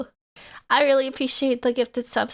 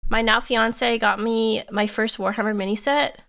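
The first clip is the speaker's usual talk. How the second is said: ordinary talk, nothing marked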